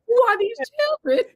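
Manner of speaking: high astonished squeal; mock astonished. Mother amazed her kids eat crawfish, high astonished. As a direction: act stunned at your own kids. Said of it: "And I was like".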